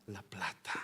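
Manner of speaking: hushed whispered scripture line; whispered verse. Acted. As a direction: breathe the verse out as a secret. Reading scripture, then whispered.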